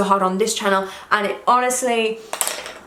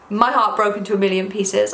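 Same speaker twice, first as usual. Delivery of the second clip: ordinary delivery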